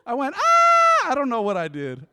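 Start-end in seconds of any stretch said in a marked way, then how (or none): 0.4-1.1 piercingly high, loud re-enacted shriek; high scream. Owner afraid squirrel will bite his dog, high scream. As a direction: imitate your own squeal at full volume. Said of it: "but my voice was high".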